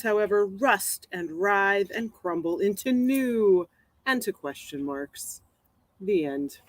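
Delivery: slow, measured poem recital; poem reading. Acted. Poet reading her axiom poem for today, slow recital. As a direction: recite it deliberately, drawing out the line ends.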